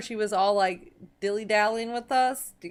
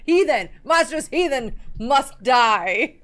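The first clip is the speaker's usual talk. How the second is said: loud, high mock-zealot cry with laughter; hammed-up proclamation; 5 semitones higher, 11 dB louder, slower than usual. Player finds a better word for the vampire, hammed-up proclamation. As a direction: declaim it grandly, drawn out and half laughing.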